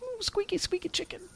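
tiny, very high soft squeak; squeaky toy voice. Pretend. Playing with news of a squeaky chicken toy, tiny squeaky voice.

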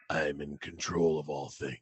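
low, soft, deadpan Batman. Retold or imitated. Retelling the cyclist in a Batman mask's reply. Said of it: "he looks at me and he goes".

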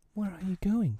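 put-on high, uneasy girl's question; misgiving. A narrator's reading. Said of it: "she cried with misgiving".

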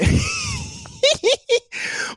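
high-pitched, sustained giggle. Real, not acted. Storyteller cracking up at his own act, high giggle. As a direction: burst into a squealing giggle. Laughs at own deep-voice habit.

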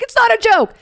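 very high, loud frantic shout; retold hysterical yell at a dog attack. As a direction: insist at the top of your voice. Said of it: "She's hysterical".

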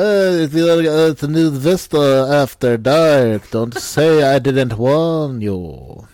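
hammed-up English accent, drawn out. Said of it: "Your accent is super on point".

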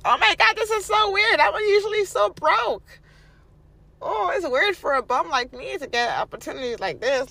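very high, loud, hammed-up disbelief; mock amazement. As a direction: send up someone astonished by unexpected money. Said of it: "don't be like".

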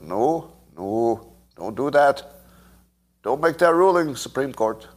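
badly faked Canadian pleading; terrible Canadian accent. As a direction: imitate a Canadian objecting, accent poorly done. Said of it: "that's a terrible accent".

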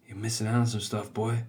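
low, hushed tempting voice; phone softly calling. Acted. A phone tempting its addicted owner, softly calling. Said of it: "the phone starts calling to you".

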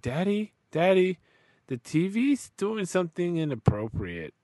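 put-on high little-kid voice; two-year-old daughter. Two-year-old comes looking for dad to report, imitated high child voice. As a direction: mimic a toddler alerting her father. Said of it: "She's like".

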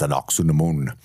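lightly voiced mummy, a little deep; a hint of a deep mummy growl. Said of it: "in his little deep mummy voice".

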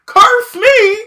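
high, loud, begging cry; melodramatic plea. Retold or imitated. Harry begging the Death Eaters, high and loud. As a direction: fling yourself at the villain begging. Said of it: "Harry over here like".

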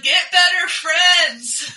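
slow, loud, stretched-out jeer; yell. Retold or imitated. Yelling at the partygoer's friends to do better. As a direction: heckle it across the road. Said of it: "I think I yelled".

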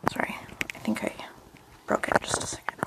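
hushed whisper. Real, not acted. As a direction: whisper so the mic misses it. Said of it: "Shit, sorry, guys. Hold on".

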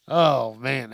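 ordinary talk, nothing marked

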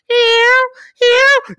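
a tiny high-pitched kitty meow; normal cat meow. Retold or imitated. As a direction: mimic a dainty little housecat. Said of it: "So most cats be like".

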